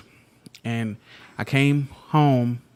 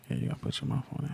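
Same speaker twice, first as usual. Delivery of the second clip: hushed close to the mic; breathy whisper into the mic; 3 semitones higher, 10 dB quieter, much faster than usual. Real, not acted. Coaching wife to the mic.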